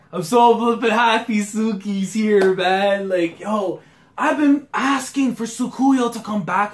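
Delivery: high, loud and slow; bursting with joy about her; loud rapturous praise; happy fan gushing. Real, not acted. Gushing about favorite character.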